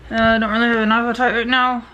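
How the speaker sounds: speaking through wired teeth; talking with mouth closed. Real, not acted. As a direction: talk with jaw wired shut. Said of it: "Just very hard to talk".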